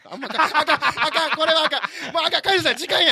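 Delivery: loud, high-pitched frantic interruption; flustered cutting-off. Real, not acted. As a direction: shut the topic down in a hurry. Host cutting off risky gossip.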